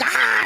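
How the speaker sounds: high startled yelp; mock scream. Imitating aunt screaming at him, mock shriek. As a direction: shriek back in imitated fright. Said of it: "just screaming".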